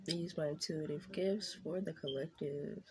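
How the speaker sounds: soft, hushed prayer; reverent, softly spoken invocation; opening prayer. Acted. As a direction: pray quietly before the reading. Opening prayer before tarot read.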